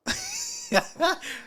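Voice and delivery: squealing high cackle; piercing giggly laugh; high-pitched laugh. Real, not acted. Laughing at own mockery.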